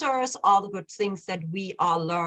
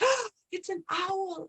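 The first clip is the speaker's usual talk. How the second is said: higher, slow, hushed mock awe after a gasp; retold awe; 10 semitones higher, 3 dB quieter than usual. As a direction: let the realization land softly. Retold gasp on seeing the owl.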